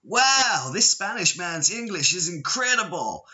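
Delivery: high, amazed mock American accent; mock American tourist. Imitating tourists amazed at his English, hammed-up American accent. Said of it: "And they're like".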